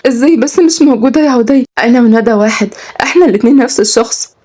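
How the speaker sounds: put-on high, protesting young woman; Kenzi, pleading. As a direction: insist on being believed.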